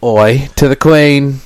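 an "Oi" toast that slips into Australian; mock British accent. Toasting the Queen in a faintly British, half-Australian accent. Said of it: "that was Australian".

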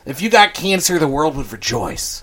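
loud, hammed-up outrage; mock tirade. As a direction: lay into him with loud mock fury. Mock tirade addressed to Zuckerberg.

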